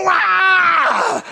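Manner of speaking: put-on high, loud child's yell; startled shriek. Daughter facing her father in the closet, high startled shout. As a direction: shriek back as the surprised child.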